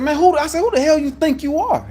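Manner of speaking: high, loud indignant yell; angry yelling. Retold or imitated. Him yelling at the disrespectful dispatcher, angry and loud. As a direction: demand who she thinks she is. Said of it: "Now I get the yelling".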